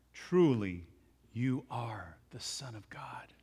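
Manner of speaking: hushed, slow, breathless confession; awed whisper. Acted. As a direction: whisper it in reverent awe. Disciples worship him in awe.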